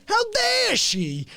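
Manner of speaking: high, slow indignant mimicry; mock outrage. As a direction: ham up his outrage. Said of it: "And then he'll go like".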